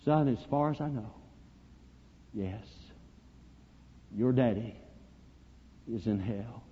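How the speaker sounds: soft, halting, grieving answer; hushed sorrow, sinking low; weeping reply. Retold or imitated. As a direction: break hard news gently, with long pauses. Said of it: "began to weep, and he said".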